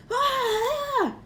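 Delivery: very high pained cry; pained scream. A narrator's reading. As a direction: yelp as your face burns. Quirrell burned.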